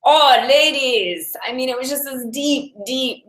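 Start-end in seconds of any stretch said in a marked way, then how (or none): 0.0-1.3 hammed-up Russian accent. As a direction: imitate a gruff Russian teacher calling the class. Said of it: "in Chinese in a Russian accent".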